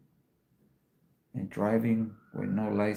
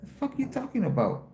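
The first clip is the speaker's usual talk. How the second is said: much higher, more scornful repeat; sharper incredulous retort; 10 semitones higher than usual. Retold or imitated. Showing the stream how he told the lady off, scornful. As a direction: throw it back at her with pitch climbing. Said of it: "I told her like that".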